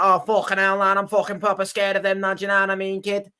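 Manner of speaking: exaggerated Liverpool accent; mock Scouse accent. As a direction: put on Scouse, pretending to be scared. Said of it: "you wouldn't do a Scouse accent".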